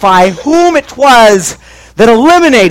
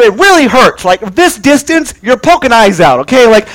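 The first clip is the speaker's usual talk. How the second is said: ordinary talk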